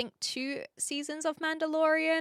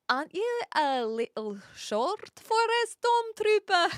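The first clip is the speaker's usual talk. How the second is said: high-pitched mock Scottish; hammed-up Scottish voice; Scottish accent; 7 semitones higher than usual. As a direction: quote it in a struggling Scots accent. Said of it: "my best attempt at a Scottish accent".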